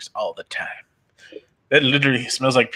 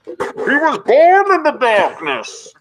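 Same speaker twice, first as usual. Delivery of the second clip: high, loud, slow villain voice; booming mock Bane; 11 semitones higher, 7 dB louder, slower than usual. Bane impression.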